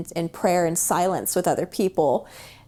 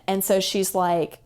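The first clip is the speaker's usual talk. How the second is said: ordinary talk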